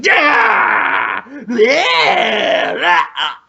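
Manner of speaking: high, drawn-out thrusting yells; hammed-up battle cry; mock attack scream. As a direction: yell wildly with each mock stab. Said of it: "thrusts the air ... just going".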